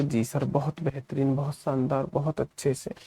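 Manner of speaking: low, soft student answer; imagined student reply. Pretend. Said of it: "आप बोलोगे".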